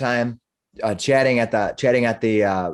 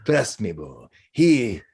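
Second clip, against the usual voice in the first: eccentric, hearty man in character, with chuckles; jovial role voice, fond of his pet; jolly hamster owner. Acted. Balding man introduces his hamster to the performer, jovial.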